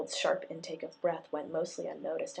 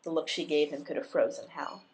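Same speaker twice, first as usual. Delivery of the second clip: plain reading voice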